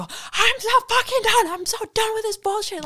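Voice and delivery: high-pitched, exasperated yell; hammed-up retold meltdown; fed-up outburst. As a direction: blow up, voice way up high. Retold blow-up in truck.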